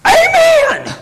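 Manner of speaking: high, full-force church amen; shouted amen. Retold or imitated. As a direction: let out an amen with everything you have. Acting out people shouting amen.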